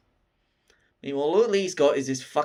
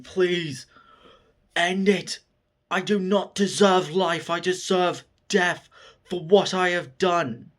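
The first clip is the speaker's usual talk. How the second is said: higher, emphatic begging; acted pleading; 5 semitones higher than usual. Broken demon begging for his own death, acted pleading. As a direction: beg earnestly, each phrase pressed out.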